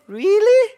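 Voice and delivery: loud, high "Really?" on a steep rise; urging the church to greet mom with a loud, high "Really?"; emphatic really. Shown as a demonstration. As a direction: belt out "Really?" in mom's emphatic way. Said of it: "say, "Really?".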